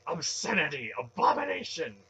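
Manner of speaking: high, loud denunciation; stern. A narrator's reading. Zealot denouncing the dark temple, loud high outrage. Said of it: "Relm said sternly".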